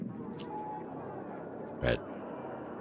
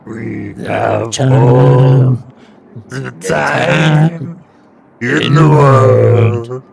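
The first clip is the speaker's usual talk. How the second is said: slow, loud, high sung impression; imitating a singer; 6 semitones higher, 13 dB louder, much slower than usual. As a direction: belt it out slowly in his voice. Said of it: "I used to be able to do his voice".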